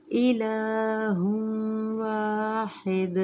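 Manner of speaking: slow melodic tajweed chant; Quran recitation. Shown as a demonstration. Recites with idgham.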